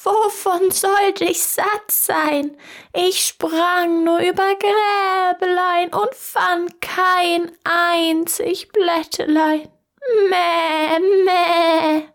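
high, slow bleating goat voice, ending in a drawn-out meh. Acted. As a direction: play a lying goat that bleats pitifully. Goat lies to the tailor. (hohe, langsame, meckernde Ziegenstimme)

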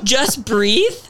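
mad mock echo. Patient repeating the nurse's advice back, mad and incredulous. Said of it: "I was so mad".

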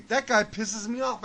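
normal talking voice

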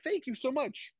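put-on sweet thanks to a mistaken fan; mock gratitude. Mock gracious reply to fans.

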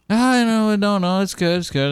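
high, dismissive reassurance sliding down; breezy seminary student. Retold or imitated. Student assures the host before preaching, high and breezy. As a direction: imitate him waving off the warning. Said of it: "he's like".

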